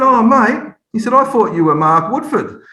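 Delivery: high, loud baffled "oh, mate"; hammed-up confused fan; mock realisation. Father realising he has the wrong player, high and baffled.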